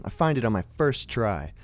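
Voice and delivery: ordinary talk, nothing marked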